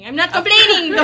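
laughing insistence. Real, not acted. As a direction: say it again, laughing and firm. Emphatic laughing repeat.

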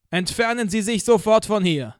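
Lärm is no part of it, just a thundering megaphone order; thundering police loudspeaker. Acted. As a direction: order the crowd off like a voice from the sky. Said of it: "sagte die Stimme Gottes".

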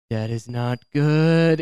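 slow, drawn-out sinking realisation; mock dread. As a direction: let the worry sink in slowly. Seeing cop make U-turn.

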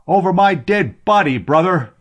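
high, loud angry challenge; heated retort. A narrator's reading. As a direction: fling it back at a rival. Rival sailors quarrel over the slave.

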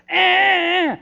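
put-on high, loud baby grunt of wanting; baby demanding. Grandpa imitating grandson Nathan pointing at what he wants, high loud grunt. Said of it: "he just points at it and he goes".

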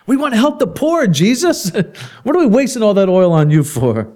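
high-pitched, hammed-up complaint; mock protest. Preacher imagining himself at the table questioning Jesus, high mock protest.

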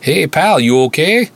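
put-on thick Minnesota accent, calling out; loud friendly Minnesota call. A narrator's reading. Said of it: "thick northern Minnesota accent".

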